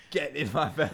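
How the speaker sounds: mocking a friend's Fat Bastard line. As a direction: ham up the Fat Bastard greed. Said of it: "always either Borat or Fat Bastard".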